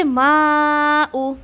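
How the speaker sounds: माऊ held long, four to five counts; recited माऊ; tajweed demo. Shown as a demonstration. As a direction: stretch माऊ slowly. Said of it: "four to five count देना है".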